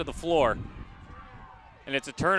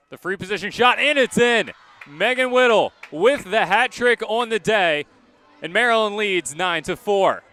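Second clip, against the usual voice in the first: rising, louder and higher as the shot goes in; excited goal call; 5 semitones higher, 5 dB louder than usual. Real, not acted. Play-by-play announcer calling a hat-trick goal to viewers, excited and loud. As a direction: call the goal with real excitement, building to the score.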